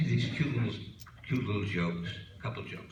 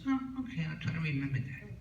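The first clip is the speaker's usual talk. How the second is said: quick high agreement; 7 semitones higher, faster than usual. Acted. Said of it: "She says".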